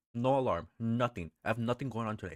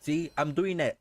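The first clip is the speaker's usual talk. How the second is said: excited; 6 semitones higher, 6 dB louder than usual. Real, not acted. Excited at figuring out game.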